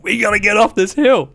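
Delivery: high, loud, urgent call to get out; urgent shout under fire. Retold or imitated. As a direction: yell it like rounds are hitting your rock. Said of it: "I was like".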